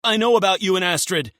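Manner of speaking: fast, high desperate blurt. Acted. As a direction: rush it out as a last play. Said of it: "he blurts out".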